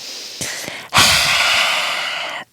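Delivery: long breathy exhale, tongue out; lion's breath exhale. Shown as a demonstration. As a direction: breathe out hard with a roar. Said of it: "Let's do that again".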